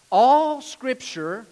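"All" drawn out long and loud; stress on ALL. Real, not acted. Preacher stressing how much Scripture is inspired, emphatic "All". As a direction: lean hard and slow on "All". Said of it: "how much of it?".

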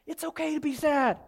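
high, insistent preacher's declaration; emphatic reassurance. Real, not acted. Emphatic first take, then softened.

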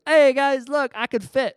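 put-on high, loud childish glee; proud little boy calling out; excited kid. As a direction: show off to the other kids. Kid's head stuck in fence.

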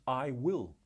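"I will" with both words pressed firmly; emphatic "I will"; stress on I WILL. Shown as a demonstration. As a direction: hit "I will" as the key words. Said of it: "Note the emphasis on the words".

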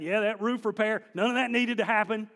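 a hint of a put-out homeowner; sarcastic mutter about the roof; grumbling disciple. Disciple grumbling about his torn roof, mock sarcastic.

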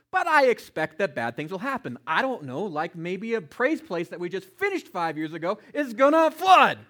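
loud preaching that bursts into a shout; emphatic preaching. Real, not acted. Preacher warning that bad things happen, building to a shout.

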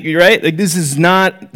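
ordinary talk

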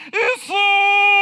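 long, high, loud drawn-out cry. Acted. Blind man calls out to Jesus, a high loud pleading cry. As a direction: call across the crowd with all you've got. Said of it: "Знаете, что он им сказал".

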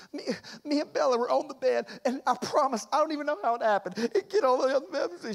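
high, hurried tearful pleading; mock-sob through a kid's excuse; imitated crying child. Daughter explaining the nail polish to daddy, crying. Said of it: "she starts crying".

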